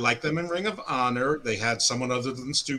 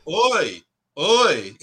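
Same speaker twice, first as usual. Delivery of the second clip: loud yelled oi; imitated yelling uncle; 4 semitones higher, 8 dB louder than usual. Showing his uncle could do the promo too, mock yell. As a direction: call out a big mock oi. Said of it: "My uncle could do that too".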